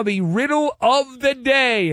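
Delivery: big high announcer lift on the riddle; announcer build-up. Acted. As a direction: present it like a show segment.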